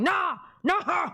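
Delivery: high-pitched mock protest; imitating a refusal. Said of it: "He was like".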